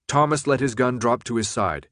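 ordinary delivery

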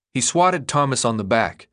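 plain reading voice

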